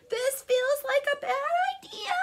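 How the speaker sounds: very high, loud worried cry; alarmed protest. A narrator's reading. Ricky protests as he slides downhill, high alarmed cry. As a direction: call out in mounting alarm.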